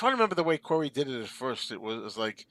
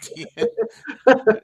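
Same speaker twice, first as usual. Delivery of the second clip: slow, louder, laughed-through remark; laughing; 10 semitones higher, 9 dB louder than usual. Real, not acted. Storyteller explaining the customer's ad-lib to friends, laughing. Cracking up at own quote.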